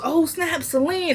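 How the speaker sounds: high, hushed "oh snap" of surprise; pleasantly startled to see an old friend; surprised greeting. Retold or imitated. Jeffrey surprised to run into Celine, high and hushed.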